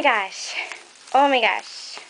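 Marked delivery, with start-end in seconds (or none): none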